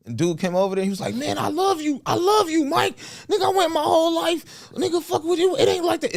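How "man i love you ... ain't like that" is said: very high, overwrought imitation; acted gushing. Dude telling Mike he loves him, acted gushing. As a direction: pour it out high and emotional. Gunman pours out love to Mike.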